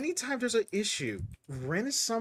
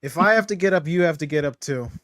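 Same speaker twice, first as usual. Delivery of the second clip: loud, grumpy insistence; loud grumpy wake-up call; 9 dB louder, faster than usual. Acted. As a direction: make her get up, loudly. Character yelling to wake her.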